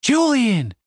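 high, loud joyful cry of a name. Acted. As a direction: cry out his name in relief. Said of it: "Stacy cried".

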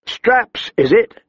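high mocking question; scoffing. A narrator's reading. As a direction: sneer at the straps. Stiv scoffs.

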